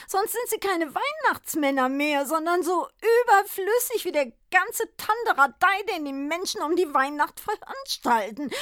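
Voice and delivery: high, loud lament building to a wail; despairing Santa Claus. A narrator's reading. Santa lamenting to the druggist, high wailing despair. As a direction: Santa crying out his misery.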